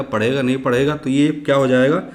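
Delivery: normal talking voice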